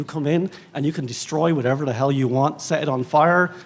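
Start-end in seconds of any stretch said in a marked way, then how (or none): none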